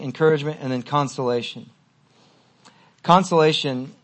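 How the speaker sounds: ordinary delivery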